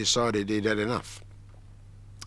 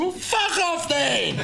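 slow, higher mock dismissal; mock rude dismissal; 4 dB louder, much slower than usual. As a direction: drag it out like a comic insult. Retells his own rude dismissal.

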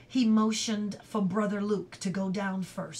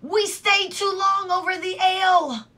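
high, loud ruffian's shout; loud, high-pitched outcry; 11 semitones higher, 10 dB louder than usual. A narrator's reading. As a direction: yell it in angry dismay. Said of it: "shouted the other".

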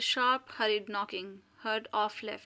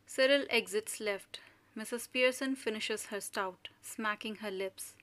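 ordinary talk

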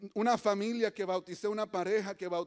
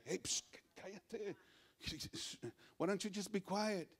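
soft, shushing scolding; hushing rebuke; 11 dB quieter than usual. Acted. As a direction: shush him under your breath. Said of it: "empezaron a reprenderle". (reprensión en voz baja, mandando callar)

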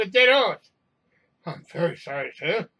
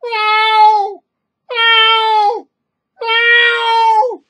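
very high, piercing cat yowl; loud agitated meow; 13 dB louder, much slower than usual. A narrator's reading. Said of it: "starts calling out loudly".

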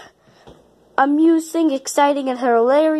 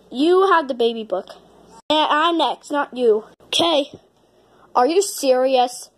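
ordinary talk, nothing marked

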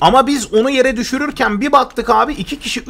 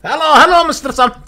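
higher, louder hello with an Indian lilt; mock Indian accent; 7 semitones higher, 5 dB louder than usual. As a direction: echo the stranger's greeting in a mock accent. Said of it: "muhtemelen Hindistanlıydı". (Hint aksanıyla hafif taklit)

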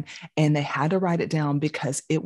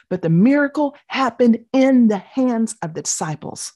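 preaching emphasis; 7 semitones higher, 5 dB louder than usual. Acted. Preacher's key point.

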